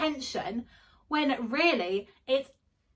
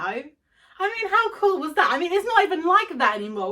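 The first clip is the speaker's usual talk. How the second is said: higher, louder gushing; excited; 7 semitones higher, 4 dB louder than usual. Real, not acted. Gushing about old buses.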